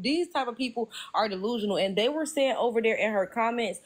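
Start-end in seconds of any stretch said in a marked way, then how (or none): none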